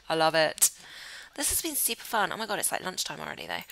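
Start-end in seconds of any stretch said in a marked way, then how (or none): none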